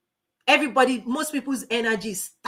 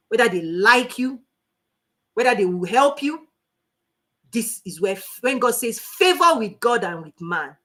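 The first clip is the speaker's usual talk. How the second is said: ordinary talk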